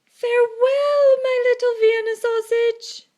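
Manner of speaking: high, loud, sing-song mother calling from the yard; calling out farewell. A narrator's reading. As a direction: call it out across the yard like a doting mother. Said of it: "waving and calling out".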